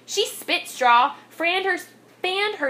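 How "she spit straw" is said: usual speaking voice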